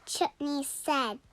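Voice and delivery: ordinary delivery, nothing marked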